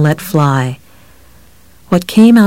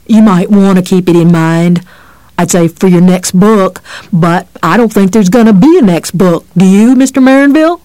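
louder, mocking cop threat; taunting threat; 2 semitones higher, 5 dB louder than usual. A narrator's reading. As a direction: taunt him with menace.

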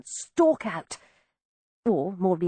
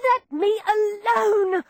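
furious; 10 semitones higher, slower than usual. A narrator's reading. Girl in a rage.

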